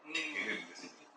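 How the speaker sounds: usual speaking voice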